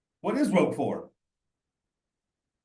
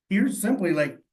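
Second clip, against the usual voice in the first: ordinary delivery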